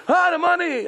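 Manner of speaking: high panicked gasp at the loss; alarmed shriek about the money; shocked cry. Acted. Friend finds money missing.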